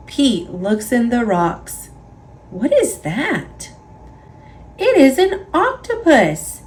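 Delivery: ordinary delivery, nothing marked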